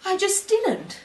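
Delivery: high-pitched, protesting innocence; indignant denial. Acted. Monologue, indignant denial of an accusation.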